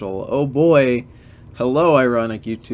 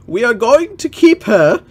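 higher, louder mock father; hammed-up proud king; father's declaration; 9 semitones higher, 6 dB louder than usual. Legolas' father announcing to the family, loud and high.

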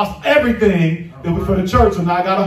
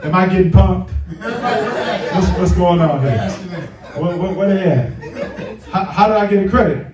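laughing disbelief. Retold or imitated. Reacts laughing to surprise credit.

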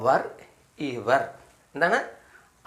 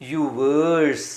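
slow drill; much slower than usual. Shown as a demonstration. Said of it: "അപ്പോൾ എന്ത് വായിക്കും നമ്മൾ?".

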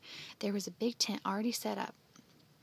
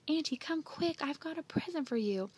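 high, eager call; calling excitedly; 6 semitones higher than usual. A narrator's reading. Andy calling his aunt to a present, excitedly. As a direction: call her over quickly. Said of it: "he called".

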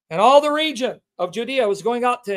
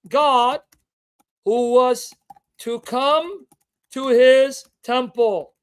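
each word hit separately, louder and slow; emphatic word by word; 5 dB louder, much slower than usual. Acted. Preacher driving home who John prepares for, word by word. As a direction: pound each word with long pauses. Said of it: "word-by-word emphatic delivery".

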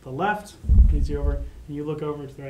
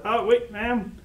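calling after someone; 7 semitones higher, 4 dB louder, slower than usual. Acted. Guard hurries after player's character.

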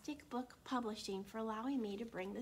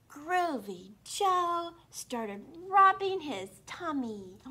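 excited; 5 semitones higher, 8 dB louder, slower than usual. A narrator's reading. Excited storytelling.

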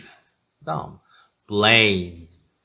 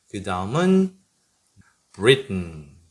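usual speaking voice

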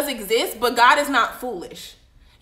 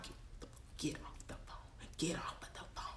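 put-on hissed whisper at her kid; urgent voiceless whisper, get off the phone; mom's whisper; 10 semitones lower, 28 dB quieter than usual. Mom whispering at her child on the phone, urgent hissed whisper. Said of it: "she's making that eye like".

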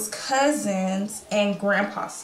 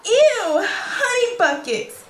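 high, loud squeal of disgust; grossed-out kid going ew; 11 semitones higher, 5 dB louder, much slower than usual. Acted. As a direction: recoil from the word like it stinks.

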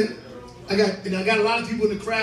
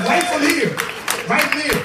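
calling out; 5 semitones higher, 4 dB louder than usual. Real, not acted. Comic rallies the club crowd from the stage: loud hype call. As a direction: hype the crowd loudly, higher and louder.

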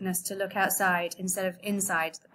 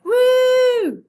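loud high cheer; 12 dB louder than usual. Acted. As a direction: whoop it out in joy. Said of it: "the refugees cheered and cried".

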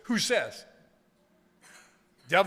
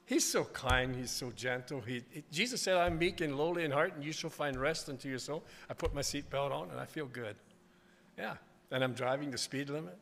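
hushed and tender; 3 semitones lower, 11 dB quieter than usual. Real, not acted. Tender aside about Jesus.

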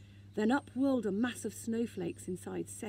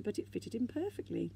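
ordinary delivery

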